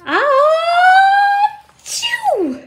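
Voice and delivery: high, loud cartoon sneeze; big sneeze. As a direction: sneeze like a bear with pepper up its nose. Bear sneezes.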